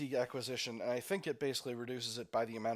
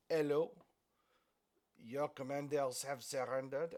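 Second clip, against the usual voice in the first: hammy mock French accent; French accent, badly faked over a radio; terrible French accent. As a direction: do a deliberately bad French accent. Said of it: "we'll do terrible French accents".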